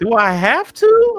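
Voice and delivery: high, slow, pleading whine; whiny plea. Retold or imitated. Asking the doctor to skip the nutritionist, slow high whine. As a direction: drag it out like a sulking kid. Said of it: "I'm like".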